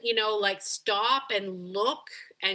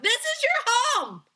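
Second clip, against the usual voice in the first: gushing dog talk; 11 semitones higher, 5 dB louder than usual. Retold or imitated. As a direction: coo it up high like showing a puppy its new home. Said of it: "We just are like".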